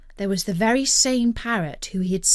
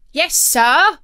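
put-on high, loud boy voice; eager young crewman reply; obedient cabin boy; 7 semitones higher, 8 dB louder than usual. A narrator's reading. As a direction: answer the captain promptly. Cabin boy answers captain.

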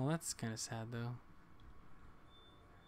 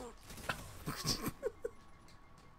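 breathy laugh; 6 dB quieter than usual. Real, not acted. Laughing at own death.

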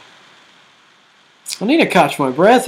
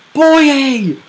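sharp high shout of a player's name; excited call; 8 semitones higher, 4 dB louder than usual. Real, not acted. Calling the shot in live commentary, excited. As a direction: yell the name as the chance comes.

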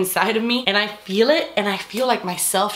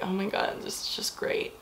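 much softer, almost whispered aside; hushed inner thought; 3 semitones lower, 12 dB quieter than usual. Retold or imitated. Thinking to herself while cracking up together, hushed. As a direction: murmur it as if to yourself.

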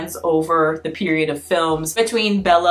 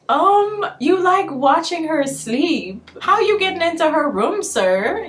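incredulous; 10 semitones higher than usual. Retold or imitated. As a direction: raise an eyebrow in your voice. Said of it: "I was like".

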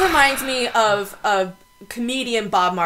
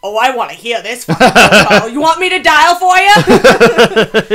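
loud, slow sarcastic taunt; mock him with a slow loud offer; 10 dB louder, slower than usual. Drunk mocking the kid's threat, slow loud sarcasm. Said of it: "I'm gonna call the police".

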